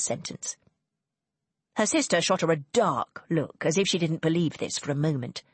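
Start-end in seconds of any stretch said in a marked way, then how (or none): none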